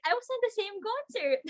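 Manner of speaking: high squeal of disbelief, laughing through it; laughing, high-pitched retold excitement; excited laughing quote. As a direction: squeal it like you can't believe the coincidence. Retelling her surprised reaction.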